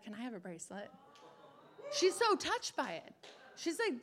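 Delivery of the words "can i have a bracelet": timid quiet ask. Retold or imitated. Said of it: "and she has said".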